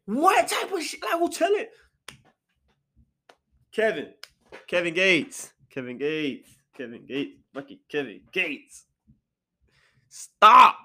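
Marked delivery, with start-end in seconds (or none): 0.1-1.7 very high, squawked questions; high-pitched incredulous protest; appalled disbelief. Real, not acted. Appalled reaction to read lyric. 10.1-10.8 one loud, raised burst; sudden loud command; scolding shout. Real, not acted. Scolding the rapper over lyrics.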